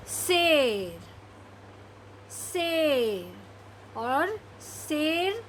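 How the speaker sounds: शेर stretched very slowly; slow reading. Shown as a demonstration. Teacher reading the word slowly for children. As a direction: draw शेर out long. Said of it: "धीरे धीरे पढ़ने की कोशिश करिए".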